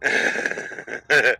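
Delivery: breath-heavy wheezing chuckle; amused laugh. Real, not acted. Comic after mimicking controllers, wheezy laugh. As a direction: laugh helplessly at own joke.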